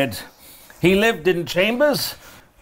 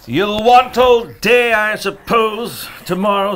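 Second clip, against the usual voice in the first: high, slow, drawn-out complaint; grudging; 5 semitones higher than usual. Acted. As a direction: stretch it out resentfully. Scrooge grudges clerk his holiday.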